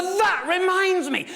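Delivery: high, slow, showy exclamation; pitched high like a one-upping storyteller; eager interruption. Retold or imitated. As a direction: leap in to grab the floor. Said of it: "leaps in with a".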